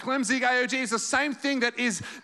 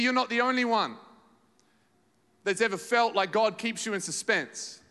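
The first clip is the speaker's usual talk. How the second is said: ordinary delivery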